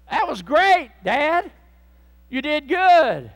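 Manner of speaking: high, loud exclaimed approval; preacher's exultant acted congratulation; hearty praise. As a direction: cheer the father on, drawing out the last words. Jesus praising the crying father.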